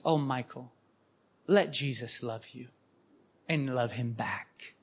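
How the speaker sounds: a hushed, motherly repeat of the advice; tender, quiet. Retold or imitated. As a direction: gently soothe a troubled man. Sisters gently repeat their advice.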